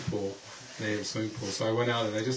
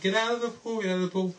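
higher yelled warning; retold scream; 9 semitones higher, much faster than usual. Daughter screaming from the poolside to get out. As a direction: call him out of the pool again. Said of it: "like, screaming".

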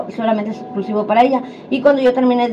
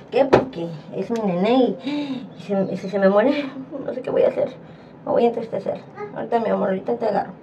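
barely breathing the words out, softer; hushed worried whisper; 7 dB quieter than usual. Real, not acted. Owner whispering to her sick puppy before the dose, hushed and worried.

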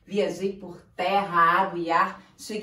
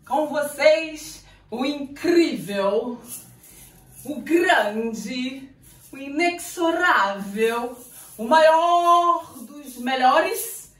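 ringmaster; 5 semitones higher, 3 dB louder, slower than usual. Acted. Circus ringmaster announcement.

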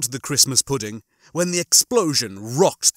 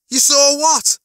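high, loud incredulous question; stunned outburst, pitched way up; shocked disbelief; 12 semitones higher, 7 dB louder, slower than usual. A narrator's reading. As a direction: yelp it back, unable to believe it. Lister told he was seen dying.

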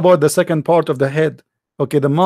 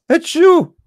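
high theatrical achoo sneezes with a laugh; mock sneeze; 10 semitones higher than usual. Acting out Adam sneezing, a high hammed-up achoo.

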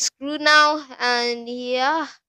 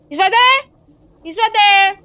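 panicked shout; 6 semitones higher, 7 dB louder than usual. Real, not acted. Spotting the monster.

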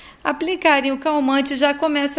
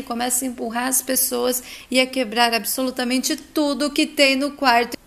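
ordinary delivery, nothing marked

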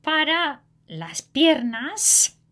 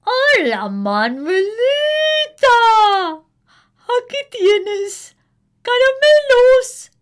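neighbor voice; 12 semitones higher, 12 dB louder than usual. A narrator's reading. Neighbor gives candy.

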